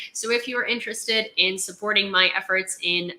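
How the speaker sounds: ordinary delivery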